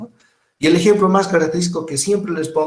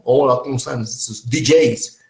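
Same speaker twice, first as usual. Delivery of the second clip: radio DJ voice; slower than usual. Retold or imitated. Teacher imitating a radio announcer greeting listeners, slowly. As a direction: play the smooth DJ on air. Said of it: "si trabajan en una radio".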